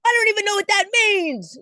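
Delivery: high, loud mock exasperated mom; imitating mad mom. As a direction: squeal it like a fed-up mother. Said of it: "And she's like".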